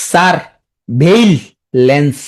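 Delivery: "সারভেইলেন্স" in three slow, separate pieces; syllable-by-syllable. Shown as a demonstration. Teacher fixing a common misspelling, slow and broken up. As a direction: pause between "সার", "ভেই" and "lens". Teacher breaks word for spelling.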